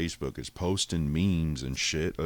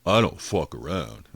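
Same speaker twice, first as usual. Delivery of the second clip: hot-headed rookie; 5 dB louder than usual. Retold or imitated. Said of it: "some hot-headed fucking rookie comes in".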